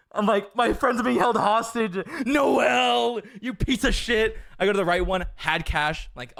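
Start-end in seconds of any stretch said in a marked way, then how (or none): 0.6-4.4 retold angry yell. Retold rage at empty cash machine.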